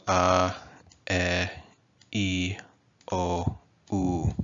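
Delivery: A, E, I, O, U like Spanish vowels; pure vowels. Shown as a demonstration. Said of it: "they're pure. They don't change".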